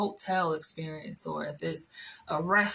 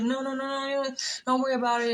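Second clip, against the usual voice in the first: quick brushing off; 4 semitones higher, faster than usual. Retold or imitated. Coworker brushing it off.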